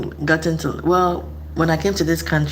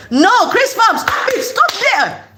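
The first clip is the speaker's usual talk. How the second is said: high, loud acted-out yell; urgent warning shout; 4 dB louder than usual. Warning someone about to run into a car, high urgent shout. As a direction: shout to halt someone in danger. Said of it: "if you're about to run into a car".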